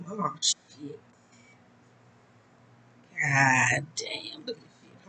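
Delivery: hushed cursing under her breath; whispered cursing. Real, not acted. Reader fumbling the deck, whispering curses. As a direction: mutter it in a whisper.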